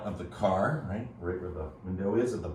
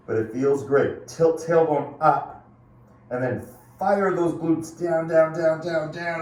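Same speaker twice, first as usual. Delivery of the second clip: coach pushing hard; 7 semitones higher, 8 dB louder than usual. Real, not acted. Coach pushing class through move.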